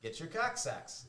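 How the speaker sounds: slow, lightly voiced Boston accent. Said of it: "what would he say".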